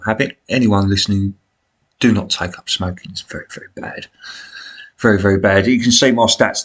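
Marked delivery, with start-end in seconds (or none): 2.3-4.1 hushed, breathy warning; whispered warning. Acted. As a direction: whisper it like a secret. Warning against smoking.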